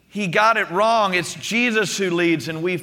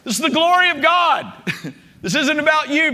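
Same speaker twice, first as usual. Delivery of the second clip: much higher, comic scolding yell; retold shout; 8 semitones higher than usual. God putting Peter in his place, retold shout. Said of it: "God the Father shouts down from heaven".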